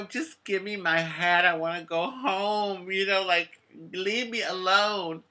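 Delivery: high, teary pleading voice; an eleven-year-old boy pleading with the older teens through tears; retold crying plea. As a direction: beg for the hat through imitated tears. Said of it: "So I start crying".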